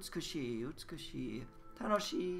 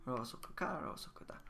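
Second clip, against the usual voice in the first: whispered Rosoku ka, Rosoku da; 7 dB quieter, faster than usual. Shown as a demonstration. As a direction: breathe the drill in a whisper. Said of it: "teacher whispers the drill".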